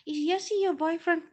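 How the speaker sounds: high-pitched mimicked question; mimicked questioner. Quoting people asking about a friend, high mimicry.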